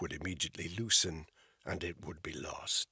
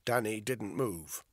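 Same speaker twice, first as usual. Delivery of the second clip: ordinary delivery, nothing marked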